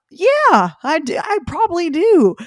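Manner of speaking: high, loud burst of agreement; exclaimed agreement. Retold or imitated. Telling how she answered dad's advice to rest, high exclaimed agreement. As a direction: blurt it out high and emphatic, as a sudden admission. Voicing own emphatic realisation in retelling.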